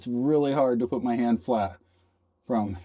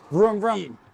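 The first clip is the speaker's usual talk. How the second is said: vroom it higher and louder, like a toy car; playful engine sound, pitched up; mock engine noise; 8 semitones higher, 4 dB louder than usual. Owner sliding the fridge in, playful engine noise.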